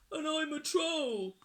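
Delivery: high little troll voice; very small voice. A narrator's reading. Troll corrects the pirate, small high voice. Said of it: "in a very small voice".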